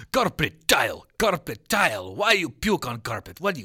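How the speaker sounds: loud, slow mock Ukrainian accent. Teacher berating pupil about carpet, loud Ukrainian accent. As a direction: hammer each word in Ukrainian accent. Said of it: "she starts going".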